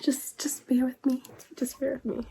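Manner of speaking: hushed, breathless whisper to spare a sore throat; soft unvoiced plea to bear with her. Real, not acted. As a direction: whisper it, voice worn out from a cold. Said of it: "I sound congested".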